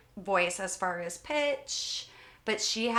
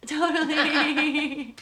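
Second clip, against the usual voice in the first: laughing; 7 semitones higher, 5 dB louder, much slower than usual. Real, not acted. Joking agreement, laughing.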